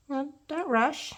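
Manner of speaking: ordinary talk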